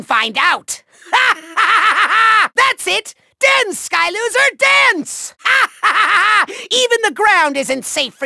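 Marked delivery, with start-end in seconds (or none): 1.6-2.5 evil cackle. Acted. Villain mocking the trapped Skylander, gloating cackle. 5.4-6.6 evil cackle. Acted. Villain mocking the trapped Skylander, gloating cackle.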